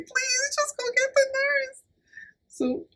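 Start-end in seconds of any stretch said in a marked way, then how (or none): 0.0-1.8 very high desperate pleading whine; tearful plea. Retold or imitated. Begging her friend to fetch the nurse, high and desperate. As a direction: beg in a thin, high near-crying voice. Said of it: "on the verge of tears".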